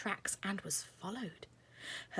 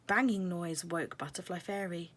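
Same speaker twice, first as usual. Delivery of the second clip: ordinary delivery, nothing marked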